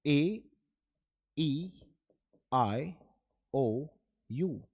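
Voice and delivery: each vowel letter said slowly on its own. Shown as a demonstration. Teacher spelling out the vowels to pupils, slow and clear. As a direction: spell out ए, ई, आई, ओ, यू one by one. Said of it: "five vowel letters बुलाए जाते हैं".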